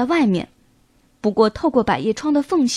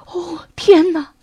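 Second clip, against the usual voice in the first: put-on slow amazed old man exclaiming; delighted surprise; 4 semitones higher, 3 dB louder, slower than usual. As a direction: gasp it out in joyful wonder. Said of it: "老裁缝惊喜地叫着".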